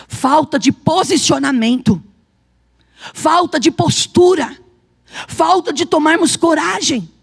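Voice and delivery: loud, slow, hammered-out list; emphatic preacher's delivery with weight on every phrase; forceful preaching. Acted. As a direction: drive each failing home to the congregation.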